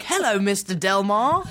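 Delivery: laughing British "Hello, Mister Delmar"; British accent. Retold or imitated. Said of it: "forgot to do an American accent".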